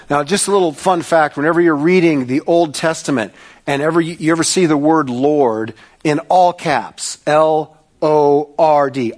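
normal talking voice

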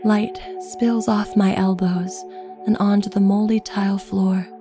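usual speaking voice

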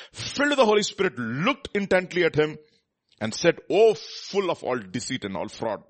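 loud fervent preaching voice. Acted. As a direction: preach the scripture with force. Preaching reading of Acts at full force.

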